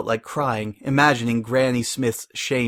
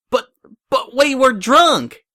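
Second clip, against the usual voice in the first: much higher, louder, slow desperate protest; 13 semitones higher, 6 dB louder, slower than usual. A narrator's reading. Applejack protesting the marriage, loud and desperate. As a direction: plead it out loud. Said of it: "Applejack protested".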